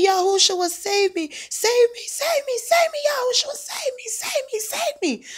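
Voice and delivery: very high, frantic repeated pleas; desperate pleading. Retold or imitated. Possessed man crying out for help.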